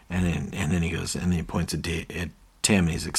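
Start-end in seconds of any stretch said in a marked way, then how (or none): none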